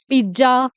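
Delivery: पिज्जा said "pij-ja"; wrong "pizza" with j. Shown as a demonstration. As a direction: put a j sound in पिज्जा. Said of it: "इसको बोलते हैं".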